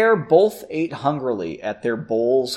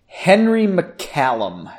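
slow, drawn-out name, raised pitch; said slowly; 4 semitones higher, 4 dB louder, much slower than usual. A narrator's reading. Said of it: "she said slowly".

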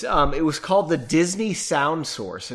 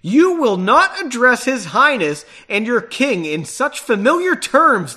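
loud, higher-pitched official rebuke; forceful acted reprimand; stern order; 6 semitones higher, 7 dB louder than usual. A narrator's reading. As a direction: order them indignantly, voice raised. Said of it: "the man ordered".

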